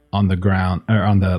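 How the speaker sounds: usual speaking voice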